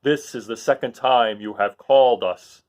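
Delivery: a regal beast speaking gravely; Monkey King. Acted. Monkey King bowing to the little girl. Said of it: "said the Monkey King".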